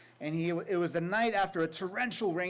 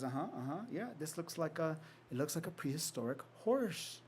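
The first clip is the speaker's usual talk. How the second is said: softer, measured teacher's assessment; quiet, considered; 7 dB quieter than usual. Retold or imitated. Teacher calmly examines the hole.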